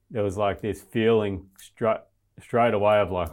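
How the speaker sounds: normal talking voice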